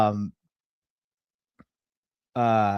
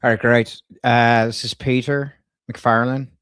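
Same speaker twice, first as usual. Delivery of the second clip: put-on Irish twang; Irish accent; 5 dB louder than usual. Prank caller leaving a message with a staffer, in an Irish accent. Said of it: "she really liked my Irish accent".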